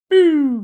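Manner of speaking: a high, loud "boo" for the shot; harpoon sound effect. Pretend. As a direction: blurt out a big sound effect.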